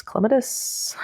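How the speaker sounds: clematis said softly as CLEM-uh-tiss; stress on first syllable. Shown as a demonstration. Painter still unsure tries clematis again as CLEM-uh-tiss. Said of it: "not ever sure which way that word goes".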